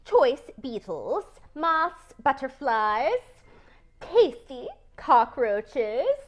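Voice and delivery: greedy spider savoring her menu; savoring list. Acted. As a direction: roll every tasty bug on your tongue.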